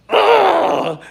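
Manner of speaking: a high, loud angry grunt; frustrated ugh. Retold or imitated. Gambit hearing the truth, furious high grunt. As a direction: let out his fury at the news. Gambit throwing cards in fire.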